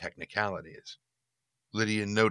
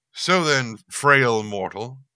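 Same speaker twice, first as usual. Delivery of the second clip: booming demon lord; 4 semitones higher, 8 dB louder, slower than usual. Acted. Demon addresses Lydia.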